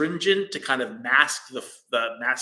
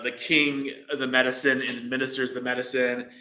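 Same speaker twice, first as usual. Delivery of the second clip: ordinary delivery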